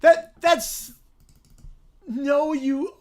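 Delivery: high, loud, near tears; overjoyed shouting. Real, not acted. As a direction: shout in shocked joy. Reacting to gift.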